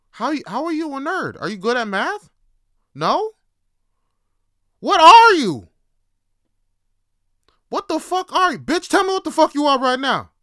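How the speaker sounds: building from loud, high questioning to a shouted outburst; exasperated mock interrogation. Grilling a fake nerd who answers nothing, escalating to outraged yelling. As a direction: explode in hammed-up disbelief.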